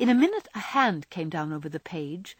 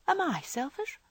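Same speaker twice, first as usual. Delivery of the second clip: higher, involuntary question of surprise; mildly taken aback, pitched up; surprised; 8 semitones higher than usual. A narrator's reading. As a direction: let the surprised question slip out. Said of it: "in a tone of surprise".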